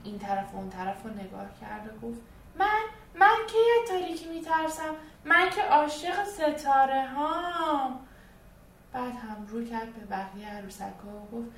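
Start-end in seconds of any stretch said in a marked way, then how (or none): 2.6-8.0 high, loud, slow offended doll voice; indignant doll. Acted. Doll Sara protests she loves the stars, high and indignant. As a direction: she is outraged at being called scared.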